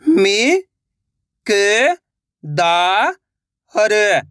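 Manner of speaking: "मि-क-दा-र" very slowly, syllables apart; sounding out slowly. Shown as a demonstration. Teacher showing children how to sound out a word. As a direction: sound out "मि-क-दा-र" piece by piece. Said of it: "एक एक अक्षर का उच्चारण करके".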